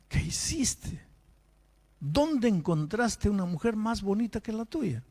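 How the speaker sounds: a pastor baffled by the husband's betrayal; incredulous. Retold or imitated. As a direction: ask as if it makes no sense. Pastor scolding in disbelief.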